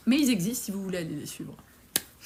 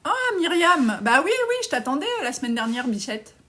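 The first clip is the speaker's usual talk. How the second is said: excited greeting; 5 semitones higher, 6 dB louder than usual. Real, not acted. Greeting a coaching client on live.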